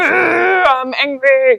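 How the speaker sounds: slow, hammed-up angry declaration; badly faked menace; mock intimidation. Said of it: "roll your intimidation".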